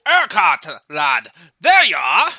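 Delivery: booming Scottish duke; hearty Scottish greeting called out; Scottish accent. Acted. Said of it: "trying to do a Scottish accent".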